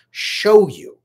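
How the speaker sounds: "show" punched high and loud; stress on show. Shown as a demonstration. Coach to viewers, stressing show over tell. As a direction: hit "show" hard, big and high. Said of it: "not just tell you".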